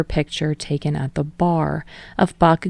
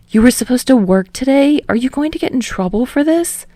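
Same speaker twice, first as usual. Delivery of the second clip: higher, anxious questions; worried; 7 semitones higher, 4 dB louder than usual. A narrator's reading. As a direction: ask in rising alarm. Kirishima worried about Bakugo's job.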